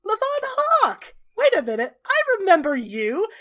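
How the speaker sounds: loud, high-pitched exclamation of discovery; excited recognition. Acted. Zevran suddenly remembering Fenris, loud and excited.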